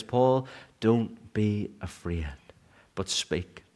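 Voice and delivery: the Lord calming Paul's fear, gently and slowly; gentle voice of the Lord. Acted. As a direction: soothe a frightened man, slowly. The Lord speaking to Paul.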